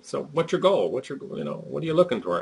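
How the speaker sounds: put-on Arkansas accent. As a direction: quiz him in a light Arkansas accent. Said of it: "in his Arkansas accent".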